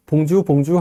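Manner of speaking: 봉주 with plain Korean sounds; flat Korean-accented French. Shown as a demonstration. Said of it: "프랑스어 같은 느낌이 좀 안 들어요".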